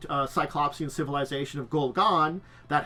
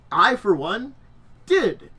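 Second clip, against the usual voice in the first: excited; 6 semitones higher, 7 dB louder, slower than usual. Real, not acted. Proud boast of his adventures.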